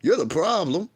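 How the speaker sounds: ordinary talk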